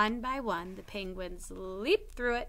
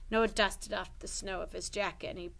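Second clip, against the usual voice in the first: ordinary delivery, nothing marked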